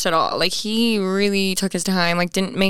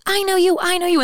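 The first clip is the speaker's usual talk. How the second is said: put-on excited kid; 11 semitones higher than usual. Young kid recognizing a vlogger, excited high voice. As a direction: squeal it like an excited little kid.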